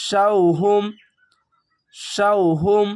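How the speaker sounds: শাউহুম with a rising end, repeated; recitation. Shown as a demonstration. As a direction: recite শাউহুম twice clearly. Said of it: "অ যাওয়ার শাউ". (শাউহুম তিলাওয়াতের সুরে)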